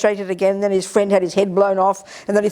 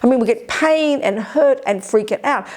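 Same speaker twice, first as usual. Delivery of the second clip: ordinary delivery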